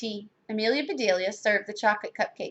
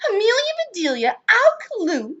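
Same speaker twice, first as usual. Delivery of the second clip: high, sharp call of names; scolding shout; 11 semitones higher, 4 dB louder than usual. A narrator's reading. Missus Rogers to maid and cousin, shouting. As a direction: shout for both culprits. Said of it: "shouted Missus Rogers".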